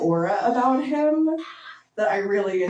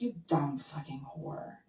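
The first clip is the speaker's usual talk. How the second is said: slow, low, much softer growl; low mock growl; 5 semitones lower, 12 dB quieter, slower than usual. Imitating the client's slow low growl in bed. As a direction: quietly growl the insult. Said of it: "he was like".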